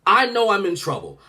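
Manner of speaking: ordinary talk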